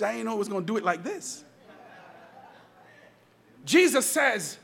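ordinary talk

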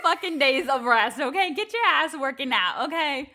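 high, loud laughing rant; laughing self-scolding. Retold or imitated. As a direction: tell yourself off, half laughing. Harsh inner voice scolds herself.

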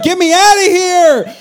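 high, slow desperate cry; desperate plea. Retold or imitated. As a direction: beg to escape. Retold fleeing car.

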